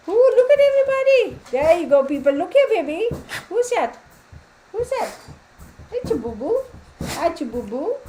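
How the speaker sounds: high singsong fussing over a pet; excited pet talk. Real, not acted. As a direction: coo at your dog in a squeaky-high pitch. Showing her dog off, baby talk.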